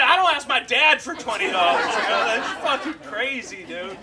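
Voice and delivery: loudly indignant, almost yelling; loud indignant retort. Retold or imitated. Retort to homeless guy.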